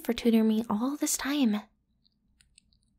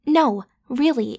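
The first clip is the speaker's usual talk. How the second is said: ordinary delivery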